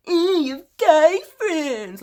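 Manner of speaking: high, slow mocking drawl of the boyfriend; hammed-up whiny boyfriend impression. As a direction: sneer it like the jealous boyfriend. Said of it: "and he's like".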